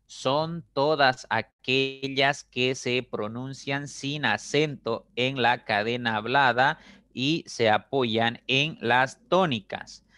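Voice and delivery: definición leída despacio, palabra por palabra; lectura pausada. Shown as a demonstration. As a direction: lee lento, separando y marcando las palabras. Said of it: "esa lectura que hice". (lectura lenta y didáctica)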